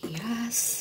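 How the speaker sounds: whisper. Real, not acted. Whispered aside to camera.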